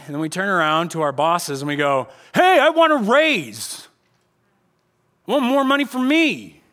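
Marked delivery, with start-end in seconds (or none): 2.3-6.6 loud, high, slow demand. Retold or imitated. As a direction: insist on it loudly to the boss. Demanding a raise from boss.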